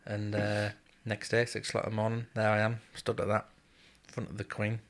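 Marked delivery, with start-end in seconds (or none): none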